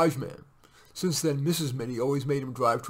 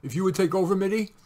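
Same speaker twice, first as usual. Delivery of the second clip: higher, louder, rising plea; a touch of nervous appeal; nervous request; 6 semitones higher, 5 dB louder than usual. A narrator's reading. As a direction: ask anxiously, rising at the end. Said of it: "said Renshaw nervously".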